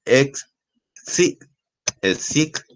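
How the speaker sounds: "Ex, sí" as isolated syllables with pauses. Shown as a demonstration. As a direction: sound out each syllable separately, teacher-style. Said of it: "porque la sílaba to".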